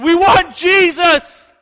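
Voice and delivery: high, slow, all-out shout. Retold or imitated. Disciple shouting for Jesus before the crowd, high and loud. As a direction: shout the name with everything you have. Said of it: "we shouted as loud as we could".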